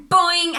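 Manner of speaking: high, loud springy boing; boing sound. Acted. Narrator voicing the spring that hits his head, loud boing. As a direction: make a bouncing spring noise.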